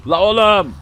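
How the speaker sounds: high, loud frantic shouts; desperate battle yells; panicked yelling. Real, not acted. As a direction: scream that you won't die. Fighting a horde, desperate.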